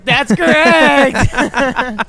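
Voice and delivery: game show host. Pretend.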